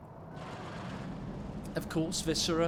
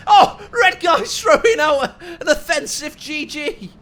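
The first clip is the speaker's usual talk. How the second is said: high, loud shout breaking into laughter; excited, laughing live reaction; amused outburst; 9 semitones higher, 13 dB louder than usual. Real, not acted. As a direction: shout it through laughter, caught off guard. Reacting live to opponent's taunt.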